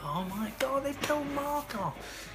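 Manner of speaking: high, drawn-out mock wail of shock; retold dismay. Retells reading friend's character death.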